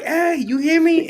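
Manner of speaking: high, loud calling to be heard; God calling out. Retold or imitated. God calling to a distracted man, high and loud. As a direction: call out to someone not listening. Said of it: "He like".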